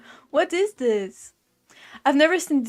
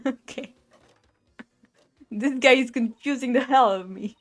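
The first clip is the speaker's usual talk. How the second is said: ordinary talk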